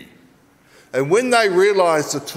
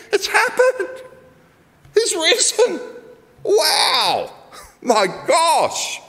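astonished joy; 13 semitones higher, much slower than usual. Acted. Women discover the empty tomb.

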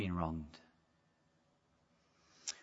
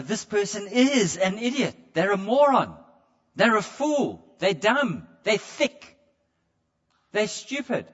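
raised-pitch scornful name-calling; spiteful mock tirade, pitched higher; malicious insults; 6 semitones higher, 5 dB louder than usual. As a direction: sneer the insults with contempt. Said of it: "there's a maliciousness in our voice".

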